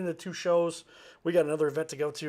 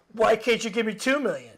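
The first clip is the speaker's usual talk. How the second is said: loud, high-pitched complaint; hammed-up indignant demand; mock demand; 7 semitones higher, 8 dB louder than usual. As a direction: whine back like an ungrateful taker. Mocks an ungrateful person's demand.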